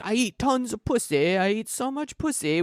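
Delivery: imitated British accent. Host mimics character at the mirror, mock British accent. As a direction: put on an awkward British accent. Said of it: "British accent".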